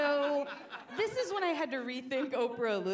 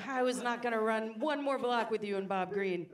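ordinary talk, nothing marked

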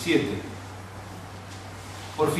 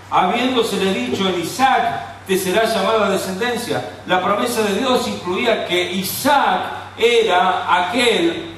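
loud, raised Scripture preaching, slowing on key words; impassioned preaching; 5 semitones higher, 4 dB louder than usual. Acted. Preacher stressing God's promise to Abraham, loud and impassioned. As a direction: lean into the promise, preaching hard.

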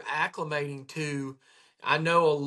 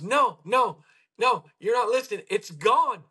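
raised, insistent quote of an excited patient; 6 semitones higher, 4 dB louder than usual. Retold or imitated. Patient telling his chiropractor, insistent and raised. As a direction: insist he really is cured. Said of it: "He goes".

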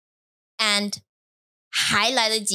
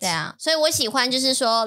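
ordinary talk, nothing marked

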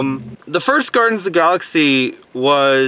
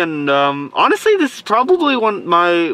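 ordinary talk

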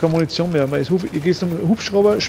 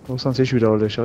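ordinary talk, nothing marked

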